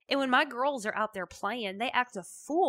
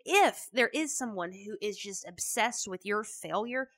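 ordinary talk, nothing marked

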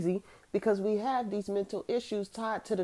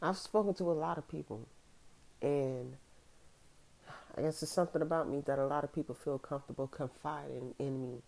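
ordinary talk, nothing marked

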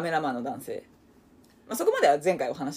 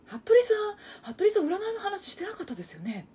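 high, sweet pouting complaint; cutesy complaining friend; 13 semitones higher than usual. Retold or imitated. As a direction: complain sweetly with lips pursed. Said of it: "へったくその原田知世みたいな".

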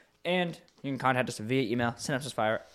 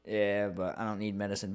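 ordinary talk

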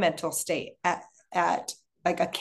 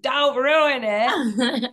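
mock dread; 5 semitones higher, 5 dB louder, much slower than usual. Mock reaction to mom's word.

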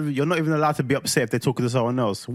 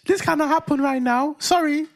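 joking high-pitched voice; put-on high voice; 10 semitones higher than usual. Man teasing his partner about his voice, put-on high pitch. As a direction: pitch it way up, as if it were yours. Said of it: "This is actually my real voice".

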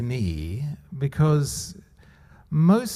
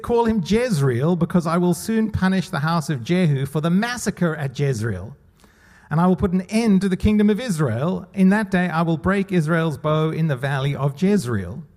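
scripture reading; 3 semitones higher than usual. Acted. Reads God's words from Hosea.

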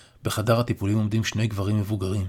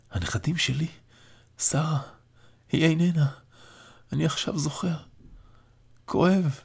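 higher, weak voice, remembering grief; frail old father in pain; 7 semitones higher than usual. A narrator's reading. Dying father recalls his late wife, weak and hurting. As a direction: let the pain surface as he remembers.

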